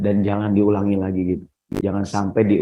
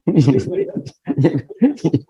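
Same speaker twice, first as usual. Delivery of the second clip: high-pitched amused laughter; laughing; 10 semitones higher than usual. Real, not acted. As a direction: burst into a long, high laugh. Preacher laughs at his own remark.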